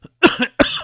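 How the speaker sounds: sharp dry cough; loud sudden cough; coughing fit. Real, not acted. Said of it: "and I'm coughing".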